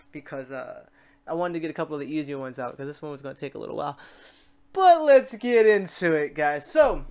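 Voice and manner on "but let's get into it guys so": loud, pumped call to dig in; rally the viewers like a hype man; hyped kickoff. Real, not acted. YouTuber kicking off his tutorial for viewers, loud and pumped.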